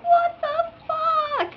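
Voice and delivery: very high, slow, stretched-out outrage; hammed-up squeal of disbelief; mock disbelief. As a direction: drag each word out up high. Acts out disbelief at drivers.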